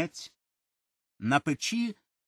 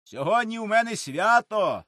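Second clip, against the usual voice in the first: loud, higher pleading shout; pleading call; 7 semitones higher, 5 dB louder than usual. A narrator's reading. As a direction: call out, begging off work. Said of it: "одгукується з хати Григор". (Григор голосно благає десятника з хати)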